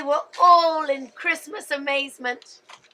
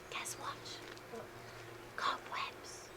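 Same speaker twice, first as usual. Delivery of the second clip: suspense whisper; 6 semitones lower, 23 dB quieter, slower than usual. Acted. Storyteller builds suspense.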